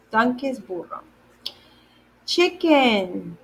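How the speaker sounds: ordinary talk, nothing marked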